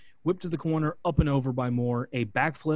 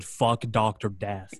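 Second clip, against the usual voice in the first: defiant curse; 3 semitones lower, slower than usual. Retold or imitated. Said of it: "This man just said".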